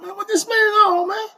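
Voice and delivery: high, disbelieving squeak of a question; incredulous. Retold or imitated. To the man about to punch him, high incredulous squeak. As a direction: pitch it way up in shocked disbelief.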